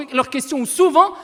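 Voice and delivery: ordinary delivery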